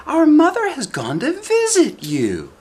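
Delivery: put-on squeaky child calling through a door; high small voice, wary; high little girl voice. Eldest daughter asks who knocks, in a high child voice.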